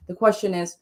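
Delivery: usual speaking voice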